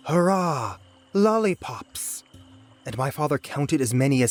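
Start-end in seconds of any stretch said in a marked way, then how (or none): 0.1-2.2 high, drawn-out gleeful cheer; storybook hurrah, pitched up and stretched; cheering shout. A narrator's reading. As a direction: cheer it like a whole eager crowd. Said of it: "A whole chorus of voices shouted".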